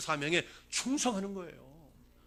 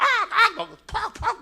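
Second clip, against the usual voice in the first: high, loud screams of pain; mock screams; 15 dB louder, much slower than usual. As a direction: shriek as if being beaten. Elephant screams while beaten. (맞으며 비명 지르는 코끼리)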